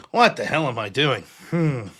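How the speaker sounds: ordinary talk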